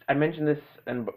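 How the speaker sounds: normal talking voice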